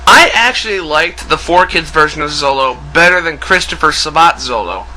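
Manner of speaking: normal talking voice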